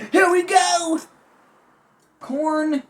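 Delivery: high, loud hype shout. Real, not acted. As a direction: kick off the meal with a big cheer. Big kickoff before eating.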